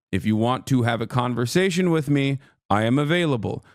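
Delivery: normal talking voice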